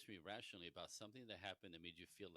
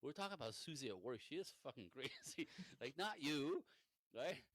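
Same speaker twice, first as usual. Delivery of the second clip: louder, laughing relief; relieved laughing husband; 5 semitones higher, 5 dB louder than usual. Retold or imitated. Husband explaining it was Susie at work, laughing relief. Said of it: "he goes".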